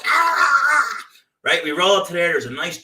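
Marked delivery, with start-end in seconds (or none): 0.0-1.0 high, loud yappy barks with a laugh; yapping little dog. Retold or imitated. Imitating the mini dog barking at the door. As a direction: bark like a tiny angry dog. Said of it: "this little dog's".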